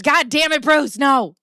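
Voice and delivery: loud, high frustrated outburst; exasperated yell. Real, not acted. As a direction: snap at the chatter, fed up. Scolding a chatter.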